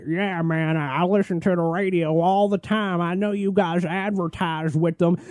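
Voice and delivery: high, dopey radio fan voice; lightly voiced meat shop guy. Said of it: "The voices that I did earlier".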